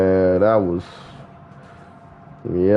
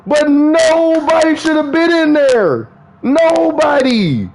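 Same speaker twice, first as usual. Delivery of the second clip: worked-up rant; 12 semitones higher than usual. Real, not acted. Venting to viewers about a movie scene, high and exasperated. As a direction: stretch out Nobody in disbelief.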